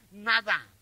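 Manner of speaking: preacher punching "nada"; stress on nada. Shown as a demonstration. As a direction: lean on "nada" like a verdict. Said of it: "está enfatizando aquí una palabra".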